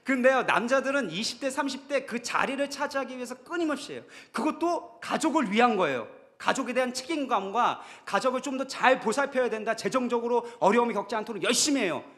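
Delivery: loud, high-pitched pulpit delivery; impassioned preaching. Real, not acted. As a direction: argue the men's case at full force. Preaches at full force.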